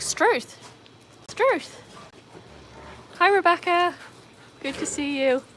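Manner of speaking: high-pitched Aussie soap character impression; Alf from Home and Away. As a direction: put on a hammy, exclaiming Australian voice. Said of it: "Uh, Elf, like".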